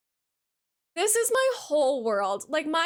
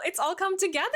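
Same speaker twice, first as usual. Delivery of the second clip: ordinary talk, nothing marked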